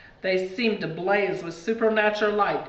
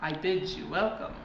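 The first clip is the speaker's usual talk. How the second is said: put-on Eastern European accent; 3 semitones lower, 6 dB quieter than usual. A narrator's reading. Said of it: "with a heavy Eastern ac-, uh, European accent".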